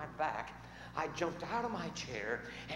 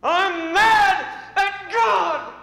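slow, furious roar, much higher and louder; angry shout; 20 dB louder, much slower than usual. Retold or imitated. Mad Dog shouting at the pastor in his office, furious. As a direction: bellow it out, every word dragged. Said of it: "his eyes so piercingly angry".

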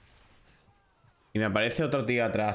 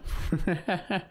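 short high-pitched laugh; resigned laugh; 7 semitones higher than usual. Real, not acted. Streamer admitting he will end up shouting, high resigned laugh. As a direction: let out a high little laugh.

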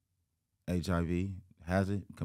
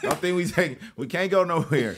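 laughing; 10 semitones higher, 9 dB louder than usual. Real, not acted. Laughing joke continues.

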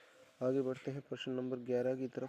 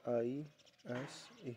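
slow spelling; 7 dB quieter, much slower than usual. Shown as a demonstration. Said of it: "यहाँ पर क्या लगा देंगे".

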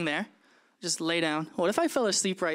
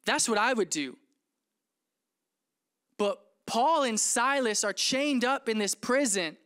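ordinary talk, nothing marked

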